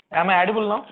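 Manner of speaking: speaking up. Real, not acted. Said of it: "your voice is very low".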